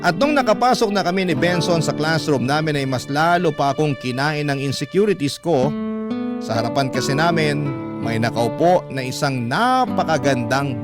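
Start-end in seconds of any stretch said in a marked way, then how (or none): none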